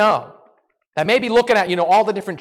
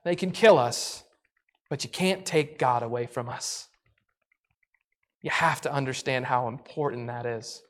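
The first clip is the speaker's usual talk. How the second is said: hushed closing sermon; 6 semitones lower than usual. Acted. Pastor closing the sermon to his listeners, hushed and earnest.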